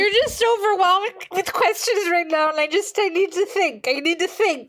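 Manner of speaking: high, overwhelmed pleading; flustered clerk. Acted. Clerk swamped by customer questions, a high flustered plea. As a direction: sound out of your depth with questions.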